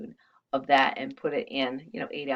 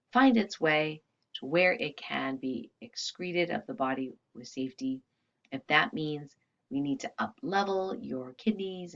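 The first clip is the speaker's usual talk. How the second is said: healing invocation; 5 dB quieter than usual. Acted. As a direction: intone each command with calm authority. Speaks healing commands, fading softer.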